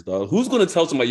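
ordinary delivery

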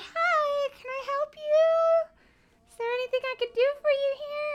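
very high-pitched imitation of a receptionist; front-desk lady. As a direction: put on a high customer-service voice. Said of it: "one of the ladies came up to me".

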